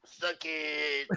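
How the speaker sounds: soft, throaty put-on catchphrase; hushed, hammy impression; Booker T. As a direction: squeeze it out quietly in character. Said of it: "we're trying to do his voice".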